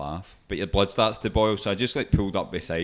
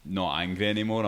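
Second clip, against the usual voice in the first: ordinary talk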